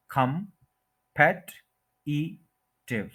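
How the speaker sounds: Competitive very slowly as kum... PET... i... tiv; competitive with long gaps between syllables. Shown as a demonstration. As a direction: break competitive into four separate syllables. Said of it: "second के ऊपर stress है".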